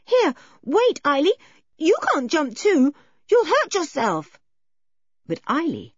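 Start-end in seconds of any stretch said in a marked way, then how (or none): 0.1-4.4 calling out. Acted. George calls after jumping girl.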